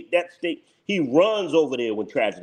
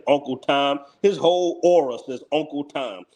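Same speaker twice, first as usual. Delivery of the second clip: ordinary talk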